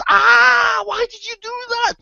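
very high, loud squeal of pain; hammed-up high-pitched wail; pained cry. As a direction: yelp as if just stung, protesting. Stung frog cries out.

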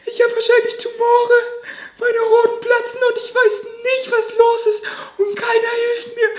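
high, breaking voice through sobs, with teary laughs; real crying. Real, not acted. Telling viewers about his hospital night while really crying. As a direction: cry it out, the voice cracking high. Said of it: "Mann, ich wollte nicht weinen".

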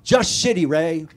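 loud cheeky reply to the deli man; mom's deadpan reply. Retold or imitated. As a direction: toss off the answer with comic bluntness. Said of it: "She'd go".

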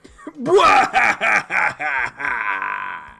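loud, long cackling belly laugh; big hammed-up laugh; hearty acted laugh. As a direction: burst into a booming bwahaha.

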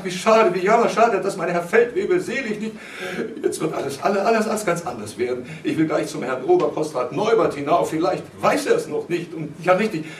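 Christine's agitated, hurried prattle; hasty, flustered chatter. A narrator's reading. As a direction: babble the news in a fluster. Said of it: "sprach Christine durch den Türspalt hastig".

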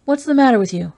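mildly forceful demand to Julie. A narrator's reading. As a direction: snap the question at her. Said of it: "I demanded".

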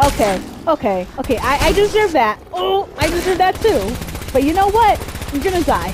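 high, loud panicky babble; scared horror game streamer. Real, not acted. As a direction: rattle it off in panic. Getting hit while fleeing.

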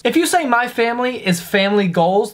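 ordinary talk

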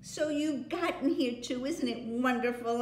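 bubbling with delight; Lucy thrilled to see Edmund. Acted. Lucy excited.

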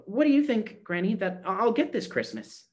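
put-on high, eager little girl. A narrator's reading. Gretchen eagerly asks Granny about her present, high and excited. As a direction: ask it bright and hopeful. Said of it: "looking up eagerly".

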